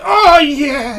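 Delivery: high loud yelp of pleasure; ecstatic cry. Retold or imitated. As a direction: blurt it out in ecstasy. Candidate crying out in ecstasy.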